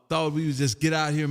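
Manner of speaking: normal talking voice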